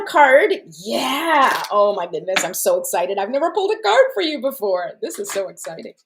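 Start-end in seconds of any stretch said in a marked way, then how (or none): none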